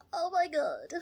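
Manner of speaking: high, loud fan squeal; starstruck gushing. Retold or imitated. Patient reliving meeting her celebrity surgeon, starstruck gushing.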